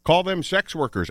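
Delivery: toned-down yell at the radio; mild mock yell. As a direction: call it out high, half-shouted. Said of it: "I was yelling at the radio".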